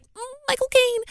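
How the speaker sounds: high, swooning sigh of a name; dreamy swoon. Retold or imitated. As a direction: melt a little as you say it. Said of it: "I'm just like".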